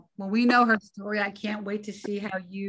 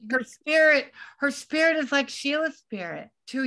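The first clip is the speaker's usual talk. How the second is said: louder and higher, gushing with delight; excited gush; 7 semitones higher, 4 dB louder than usual. Real, not acted. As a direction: burst out fondly after laughing. Laughs, then gushes about actress.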